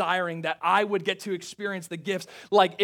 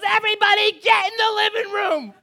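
much higher, louder call through the house; mom yelling out; 8 dB louder than usual. Retold or imitated. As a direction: summon the whole family at full voice. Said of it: "she yells out to the rest".

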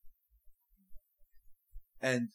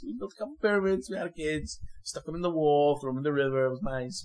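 higher, brisk mock cheerful list; deadpan sarcasm; 6 semitones higher, 6 dB louder, faster than usual. Preacher listing Egypt's horrors as pleasant memories, higher sarcasm. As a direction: rattle off horrors like holiday fun.

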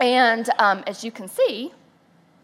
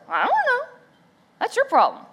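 higher breezy husband voice; mock carefree husband; imitating husband; 13 semitones higher than usual. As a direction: toss it off carelessly. Husband shrugs off problem.

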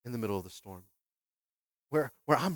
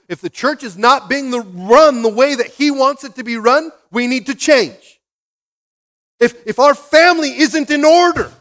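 loud, high, stretched-out exhortation; full-force preaching; 9 semitones higher, 15 dB louder, slower than usual. Real, not acted. Preacher telling the church we need to change, forceful.

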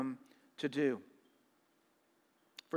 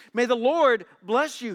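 bright, eager greeting, a bit higher and louder; cheerfully; 5 semitones higher, 6 dB louder than usual. A narrator's reading. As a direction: greet him all cheer and confidence. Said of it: "Saul greeted him cheerfully".

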